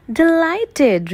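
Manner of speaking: character voice. Acted. Stickleback voiced high.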